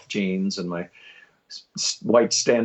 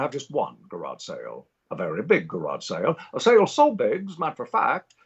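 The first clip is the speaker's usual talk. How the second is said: Pepperidge Farms guy. Shown as a demonstration. Recreating his first radio spot for the interviewer, old New England accent. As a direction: sell it like an elderly New England storyteller. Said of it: "talk like a seventy-five-year-old man from New England".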